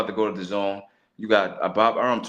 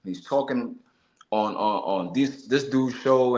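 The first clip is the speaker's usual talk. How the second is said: usual speaking voice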